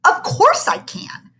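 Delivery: indignant. Acted. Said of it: "said Calvin indignantly".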